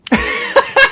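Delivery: loud, high burst of laughter; hearty laugh. Real, not acted. Laughs at own story.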